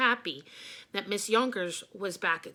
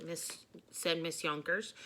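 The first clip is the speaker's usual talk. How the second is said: usual speaking voice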